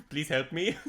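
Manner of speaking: put-on Indian accent, breaking into laughter; laughing through a mock Indian accent; laughing Indian scammer voice. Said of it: "reads like an Indian tech support scam".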